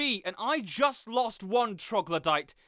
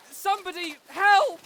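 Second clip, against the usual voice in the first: high, loud panicked call; a frightened high yell for help; cry for help; 9 semitones higher, 7 dB louder than usual. Acted. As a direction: shout for anyone to come help. Calling out while attacked.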